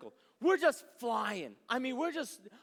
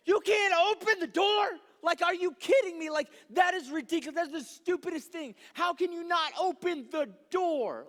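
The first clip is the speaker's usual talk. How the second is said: higher, louder exasperated yelling; outraged disbelief; 6 semitones higher, 6 dB louder than usual. Retold or imitated. Frustrated passenger reacting to the stuck door, loud outraged yell. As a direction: let the disbelief explode.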